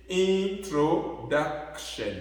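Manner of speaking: INTRODUCTION very slowly, stress on "duc"; next-to-last syllable stressed. Shown as a demonstration. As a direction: draw out "introduction", accenting the "duc". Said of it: "l'accent sur l'avant-dernière syllabe".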